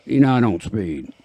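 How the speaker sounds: ordinary delivery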